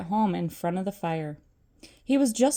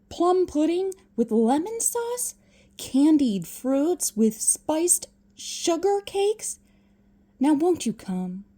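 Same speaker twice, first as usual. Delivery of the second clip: enthusiastic nephew voice; 7 semitones higher than usual. Acted. Fred tempting uncle.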